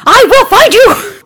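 very loud, high slow yell; furious shout. Acted. Sergei screaming at the hunter over comms. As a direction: bellow the threat.

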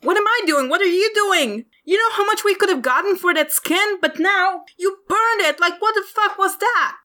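high, indignant rant; angry hunter. Acted. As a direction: throw his question back at him, furious. Angry hunter yells back.

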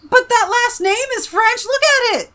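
loud, high, slow insistence; exasperated protest. Real, not acted. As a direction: argue back loudly, pitch way up. Mock argument over a French surname.

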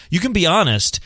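normal talking voice

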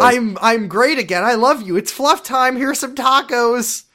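high, gushing happy voice; mock cheerful. Co-host voicing Ricky home from therapy, mock-cheerful. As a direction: chirp it sing-song, drawing out the end.

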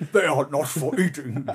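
put-on orc growling out an order; mock monster voice. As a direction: play a film orc, hammed up. Answers in film orc voice.

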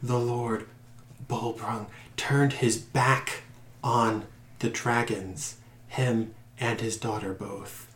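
slow, feeble, near-whispered voice; fading captive, words breathing out; weak voice. Acted. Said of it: "this weak voice".

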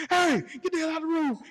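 high, loud mock yell at the intruder. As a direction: shoo him out in a squeaky holler. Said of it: "telling him".

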